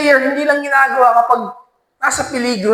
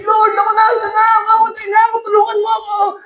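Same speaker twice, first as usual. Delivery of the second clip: crying, panicked plea; 11 semitones higher, faster than usual. Acted. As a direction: wail to the Lord, sure you'll die. Captive facing execution, crying out. (umiiyak na sigaw ng pagmamakaawa)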